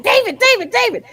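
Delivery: very high, loud rousing calls; urgent calling out. Retold or imitated. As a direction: reenact excitedly waking someone up. Waking husband after running in.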